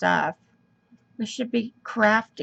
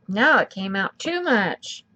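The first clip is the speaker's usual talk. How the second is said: ordinary talk, nothing marked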